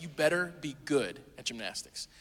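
better pressed hard, low and falling; stern, better stressed. Shown as a demonstration. Dad repeats warning to son, better stressed. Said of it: "I said it just like that".

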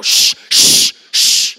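loud, forceful shushes; intense shushing. Retold or imitated. Dad hushing his yelling son, intense loud shush. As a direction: shush hard, like yelling without voice. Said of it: "It's like this yelling".

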